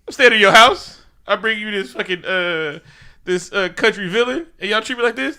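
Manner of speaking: high, loud aggrieved complaint; mock outrage at the royal court; indignant rant. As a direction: vent like you've been wronged. Retold angry complaint.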